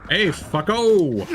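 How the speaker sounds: ordinary delivery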